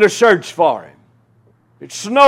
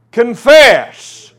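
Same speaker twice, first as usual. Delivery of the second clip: ordinary talk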